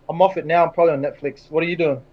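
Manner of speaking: ordinary delivery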